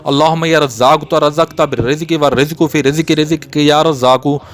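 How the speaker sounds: ordinary delivery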